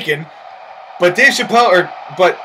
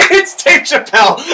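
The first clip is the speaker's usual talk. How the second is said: laughing through the words; 7 semitones higher than usual. Real, not acted. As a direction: crack up mid-call. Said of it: "Oh, that was funny".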